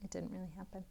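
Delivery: ordinary delivery, nothing marked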